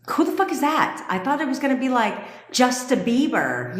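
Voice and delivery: high, bewildered who-the-fuck; baffled outburst. Retold or imitated. To the chat about celebrity names, baffled and high. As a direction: sound stunned at the unknown name. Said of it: "I'm like".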